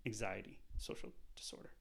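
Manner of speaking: hushed whisper; whispered aside. Real, not acted. Comic confessing his diagnosis, sudden whisper. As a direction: drop it to a whisper.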